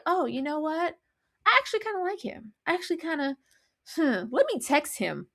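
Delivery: put-on high, soft, dreamy girly voice; airy lovestruck musing; mock swooning girl. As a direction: play a smitten woman lightly, up high. Acting out the woman's change of heart.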